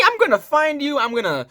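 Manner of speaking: high-pitched playful threat; mock threat. Teacher playfully threatening the learner, mock menace. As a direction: threaten them in a high, jokey voice.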